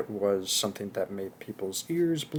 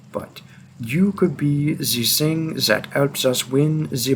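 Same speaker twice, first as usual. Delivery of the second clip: put-on French accent. Hunter forcing the listener to come along, French accent. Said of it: "tried to do a French accent".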